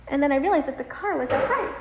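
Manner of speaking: normal talking voice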